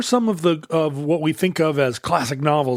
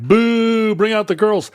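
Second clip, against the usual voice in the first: mock boo from the crowd; rowdy heckling; 6 semitones higher, 3 dB louder, slower than usual. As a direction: heckle like a rowdy audience member. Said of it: "rather than being like".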